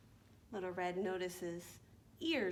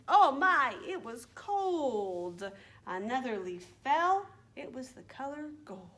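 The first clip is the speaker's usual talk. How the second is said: slow, lilting rhyme for little ones; children's rhyme recital; 5 dB louder, slower than usual. Acted. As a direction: recite slowly with stretched key words.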